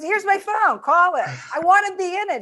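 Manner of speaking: high, emphatic retold plea; worked-up imitation of an insistent man; eager insistence.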